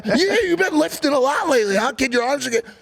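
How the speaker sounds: high-pitched imitation of gushing praise; butter him up in a high voice; overeager flattery. Imitating friend flattering him in the car, high and overeager.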